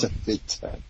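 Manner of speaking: breathy whisper; whispered aside. Real, not acted. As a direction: whisper it sideways. Innuendo aside.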